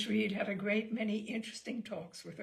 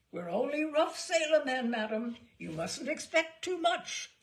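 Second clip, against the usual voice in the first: louder, higher bellowing sailor; bellowing captain; 5 semitones higher, 7 dB louder than usual. A narrator's reading. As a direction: bellow it like a rough sea captain. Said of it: "could not speak without bellowing".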